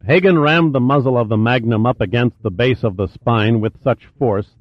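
usual speaking voice

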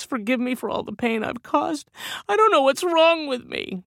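high, wavering sobbing plea; a broken man begs for forgiveness while sobbing. Acted. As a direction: blubber it out through tears. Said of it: "his sobs became deeper and harder".

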